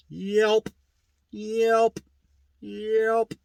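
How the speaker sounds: high, separate "yelp" calls with gaps; mimicked turkey yelp. Shown as a demonstration. Teacher showing how a yelp is made, high spaced-out turkey mimicry. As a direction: sing out each "yelp" high, one at a time. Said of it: "that's how a yelp is made".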